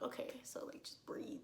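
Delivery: whisper, calming herself; whispered self-talk. Retold or imitated. Clinging to the rocks, whispering to herself to breathe. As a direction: hush it out to stay calm. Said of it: "So I'm like".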